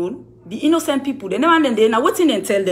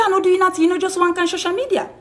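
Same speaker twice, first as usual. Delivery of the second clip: high mocking denial; 8 semitones higher, faster than usual. Quoting Henrietta's denial again.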